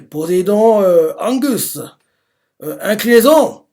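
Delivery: loud, high anime attack call; shouted incantation. Retold or imitated. Villain summoning Poseidon's power, loud high shout. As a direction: bellow the spell to the sky.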